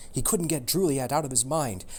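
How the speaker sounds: ordinary delivery, nothing marked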